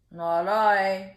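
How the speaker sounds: a big dog saying no. Acted. Dog turning down the hen, loudly.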